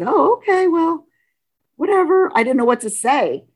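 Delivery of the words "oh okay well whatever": flustered reply. Retold or imitated. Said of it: "And I just said".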